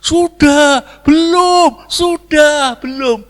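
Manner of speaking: high, loud back-and-forth chant; two camps shouting. Acted. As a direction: shout as rival halves of a crowd. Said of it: "Satu teriak". (teriakan jemaat bersahutan, melengking)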